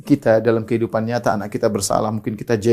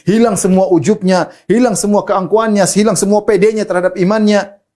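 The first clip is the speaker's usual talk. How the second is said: raised, loud impassioned sermon; fervent preaching; 7 semitones higher, 5 dB louder than usual. Acted. Preacher urging listeners on repentance, impassioned and loud. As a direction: drive the point home to the congregation.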